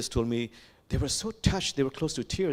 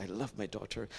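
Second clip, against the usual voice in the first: softer, lower, tender; a softer, heartfelt second take; tender repeat; 3 semitones lower, 9 dB quieter than usual. Retold or imitated. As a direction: let the repeat sink in tenderly. Repeated, father's love for daughter.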